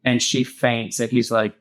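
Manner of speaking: ordinary talk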